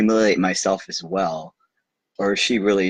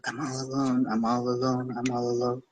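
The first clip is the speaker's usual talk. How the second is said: higher, softer far-off echo; 5 semitones higher, 5 dB quieter than usual. A narrator's reading. The echo repeating to the little prince, higher and softer. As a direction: let it ring back from far away. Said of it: "responded the echo".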